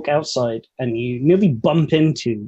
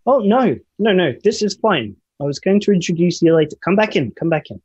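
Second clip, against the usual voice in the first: raised, higher-pitched call; 6 semitones higher than usual. Acted. Council president calls a student back in, calling out. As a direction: call out urgently to stop someone leaving. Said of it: "And he shouts, he shouts".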